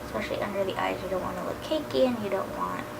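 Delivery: usual speaking voice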